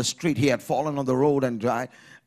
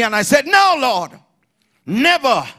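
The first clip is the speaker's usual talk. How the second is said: high, shouted vow; preaching at full force; 11 semitones higher, 10 dB louder than usual. Real, not acted. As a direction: shout it with total conviction, words stretched.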